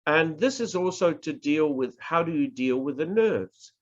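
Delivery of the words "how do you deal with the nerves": ordinary delivery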